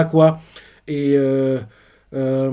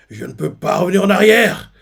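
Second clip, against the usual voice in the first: loud, higher, weeping oath; tearful vow; 5 semitones higher, 6 dB louder than usual. Retold or imitated. Weeping Harald vows never to tolerate evil. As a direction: swear it through tears, rising to a shout. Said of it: "là, il pleure".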